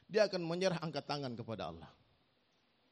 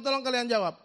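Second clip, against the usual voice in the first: urgent demand; 7 semitones higher, 10 dB louder than usual. Retold or imitated. Demanding answer from congregation.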